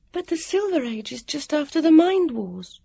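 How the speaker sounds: put-on high, far-off girl's voice, a little sick; queasy. A narrator's reading. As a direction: say it with a queasy stomach. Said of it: "Vivian said queasily".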